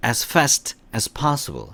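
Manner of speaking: very slow, every word drawn out; teacher's slow repeat-after-me pace; slow model reading. Shown as a demonstration. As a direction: read it slowly for students to repeat. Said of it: "시작".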